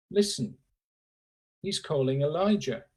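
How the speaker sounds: ordinary talk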